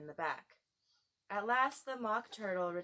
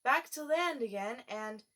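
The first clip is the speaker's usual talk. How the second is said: calling out; 4 semitones higher, 6 dB louder than usual. Acted. Gryphon calling out.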